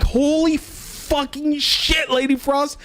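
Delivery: very high, drawn-out mock scream; retold screaming. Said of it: "like, screaming".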